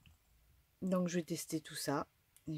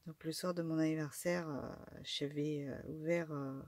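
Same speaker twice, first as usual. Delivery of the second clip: ordinary talk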